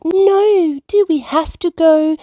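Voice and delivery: high, whiny plea to keep playing; pleading cubs. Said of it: "The bears cried".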